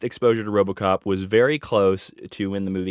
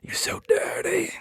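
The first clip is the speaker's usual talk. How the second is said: whisper; 6 semitones higher, 8 dB quieter than usual. Pretend. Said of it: "it was like".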